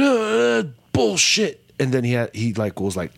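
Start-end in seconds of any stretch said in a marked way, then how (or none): 0.0-1.6 high-pitched mock exclamation; imitated wrestler's retort. Imitating wrestler cursing during his promo, high mock outburst. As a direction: blurt it as a mocking impression, pitched way up. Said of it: "but Randy says bullshit".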